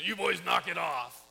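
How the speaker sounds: neighbor scolding. Retold or imitated. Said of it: "After about the tenth time, said".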